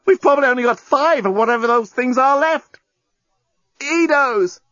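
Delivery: high, impassioned urgency; urgent cry. A narrator's reading. Lovers counting the Edos left, crying urgently. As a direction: cry out against the clock. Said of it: "she cried".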